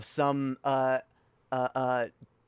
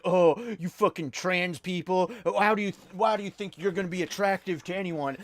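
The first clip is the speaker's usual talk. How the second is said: mock troll; 3 semitones higher than usual. Mocking the troll's line.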